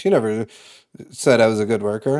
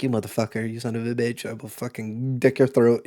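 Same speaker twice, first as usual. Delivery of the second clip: inner curse; 2 semitones lower, 7 dB quieter than usual. Retold or imitated. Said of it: "While internally, I'm like".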